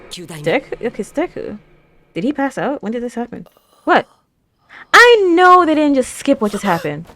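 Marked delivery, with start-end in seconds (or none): 3.8-7.1 much higher and louder, protesting; indignant outburst. Real, not acted. Viewer protesting a skipped scene, indignant and loud. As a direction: call out the show in disbelief.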